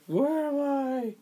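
high, slow, dazed where am I; hammed-up confused cyborg; dazed mock robot. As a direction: sound lost and just woken. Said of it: "Instead of being like".

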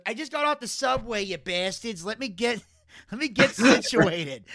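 high, loud cartoon dad impression; Peter Griffin voice. Fan retelling a subway rant, high loud Peter Griffin voice. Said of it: "he goes".

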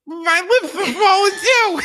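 slow, high, loud laughing wail; laughing panic. Retold or imitated. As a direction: wail it while cracking up. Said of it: "she goes".

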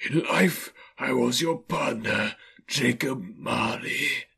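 slow, drawn-out spectral voice; eerie, unhurried ghost voice; Marley's ghost. A narrator's reading. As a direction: intone it slowly as a haunting spirit. Marley's ghost names himself.

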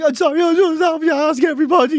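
high put-on guard voice; apologetic young guard. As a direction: imitate an over-apologetic young officer. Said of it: "and they're like".

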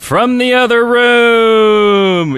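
slow, big announcer title read. Acted. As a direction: announce it like a show segment.

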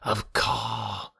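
ordinary delivery, nothing marked

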